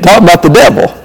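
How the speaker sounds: ordinary talk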